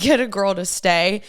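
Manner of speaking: normal talking voice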